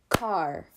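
Car as one separate, drawn-out beat; clapped syllable. Shown as a demonstration. Teacher leading preschoolers in clapping, Car as one clapped syllable. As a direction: say Car on a single clap, set apart. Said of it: "clap the syllables we hear in the word car".

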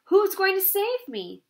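high, anxious question; mildly distressed cry; cried out. A narrator's reading. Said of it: "cried Wilbur".

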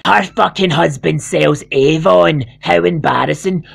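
ordinary talk

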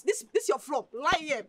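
ordinary talk

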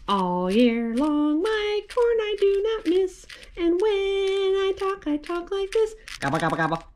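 high silly chanted turkey rhyme; turkey puppet voice. Pretend. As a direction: play the turkey puppet, sing-song.

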